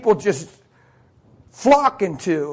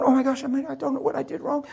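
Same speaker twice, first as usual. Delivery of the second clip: softer, higher fretful voice; imitated overwhelmed waitress; 3 semitones higher, 9 dB quieter, faster than usual. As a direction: fret quietly like a flustered new waitress. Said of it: "So now she's overwhelmed".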